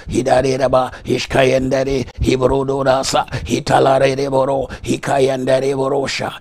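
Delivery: ordinary talk, nothing marked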